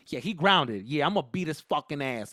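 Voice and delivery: mildly angry mom impression; threatening mom. Mom threatens her son before his friends, mildly menacing. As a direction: imitate a mom promising a whooping. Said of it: "she said it like that".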